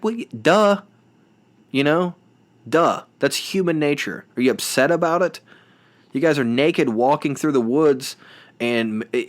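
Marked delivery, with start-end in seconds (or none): 0.0-5.4 higher, mock-incredulous duh; host answering the contestant on the show, sarcastic; sarcastic retort. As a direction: talk down to her like it's obvious.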